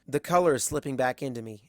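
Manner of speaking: ordinary delivery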